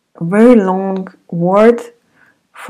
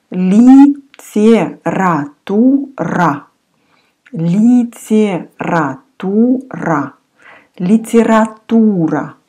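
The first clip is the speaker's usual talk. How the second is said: Литература, very slow, every syllable clear; teacher drawing out Литература; slow model word; slower than usual. Shown as a demonstration. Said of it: "Maybe you study literature".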